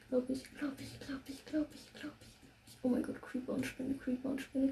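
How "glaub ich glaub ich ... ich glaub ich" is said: repeated words sinking to a whisper; fading whisper. Real, not acted. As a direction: let it fade into a whisper. (ins Flüstern verklingend)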